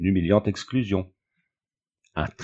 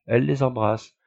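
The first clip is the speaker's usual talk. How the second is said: ordinary delivery, nothing marked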